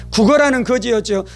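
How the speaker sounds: usual speaking voice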